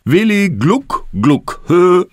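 loud, high gloating taunt; slow, high sing-song mockery; mocking laugh. A narrator's reading. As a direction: jeer like a teasing brother. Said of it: "lachte Tobi".

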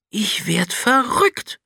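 high, slow astonished outburst; blurted out. A narrator's reading. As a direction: blurt it out in disbelief. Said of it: "stieß Niels hervor". (hervorgestoßen, ungläubig)

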